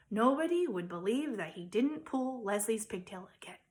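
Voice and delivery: ordinary delivery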